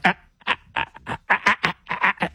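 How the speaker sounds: mock huffing. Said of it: "huffing and puffing to get in".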